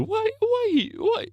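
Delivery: mock Nigerian accent, extremely high "Why" questions. Shown as a demonstration. Demonstrating how the mock Nigerian accent starts, very high. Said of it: "It's really easy to start the Nigerian impression".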